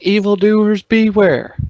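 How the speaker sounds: high, loud battle cry to the villains; mock heroic yell. Player wishing to warn off evildoers, heroic yell. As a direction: yell it out like a would-be hero. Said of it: "I really badly want to yell out".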